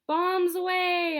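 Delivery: high, loud, slow bombs away; high drawn-out battle cry; shout. A narrator's reading. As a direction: yell it as you let go. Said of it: "I shouted".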